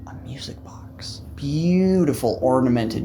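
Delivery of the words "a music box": whispered storytelling wonder; hushed reveal. Pretend. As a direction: whisper it like a precious secret.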